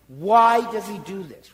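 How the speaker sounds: ordinary delivery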